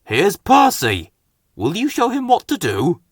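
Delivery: cheerful introduction. A narrator's reading. Fat Controller introduces Percy.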